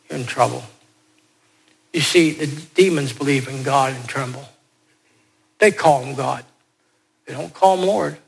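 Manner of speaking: usual speaking voice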